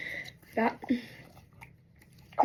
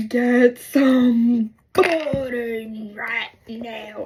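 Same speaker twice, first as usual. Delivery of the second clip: loud, slow emphatic order; retold loud demand; 6 dB louder, much slower than usual. Stuck girl demanding help, loud drawn-out order. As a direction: belt it out word by word.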